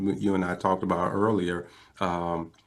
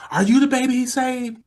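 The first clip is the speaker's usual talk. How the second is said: very high, imitated amazed question; astonished questioning; 4 dB louder than usual. Wife asking the caller who he is, imitated astonishment. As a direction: press for confirmation in a high voice.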